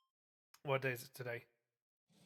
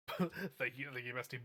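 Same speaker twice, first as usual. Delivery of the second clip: usual speaking voice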